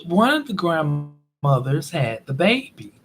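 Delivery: normal talking voice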